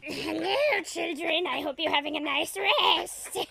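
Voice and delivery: high, loud mock-sinister meditation leader; hammed-up evil storyteller. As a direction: terrorize the children with a creepy guided meditation. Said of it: "Evil jazz voices in their dreams".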